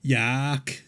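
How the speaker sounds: a flat Yorkshire "Yak", vowel opened to a. Retold or imitated. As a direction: say "Yak" the way a Londoner mocks Yorkshire.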